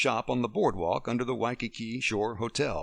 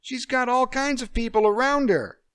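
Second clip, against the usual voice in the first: higher and louder, indignant protest; shrill indignation; 14 semitones higher, 7 dB louder than usual. Acted. Amy arguing with John about leaving Emily. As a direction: throw it back at him, loud and high.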